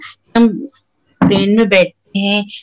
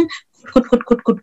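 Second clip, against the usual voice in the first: first कुट breathed, then higher clicky कुट कुट; pecking sound demo; 4 semitones higher, 3 dB quieter than usual. Shown as a demonstration. Teacher asking children to listen closely, crisp pecking कुट. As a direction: peck out each कुट crisply. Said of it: "गौर से सुनना आप लोग".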